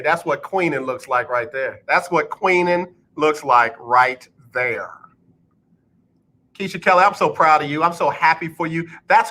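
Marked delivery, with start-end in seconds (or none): 1.9-4.9 slow, spaced-out emphatic delivery; deliberate and drawn out, each phrase set apart; slow repeat. Shown as a demonstration. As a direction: spell it out slowly for the back row. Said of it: "I'm gonna say it again slow for the people in the back".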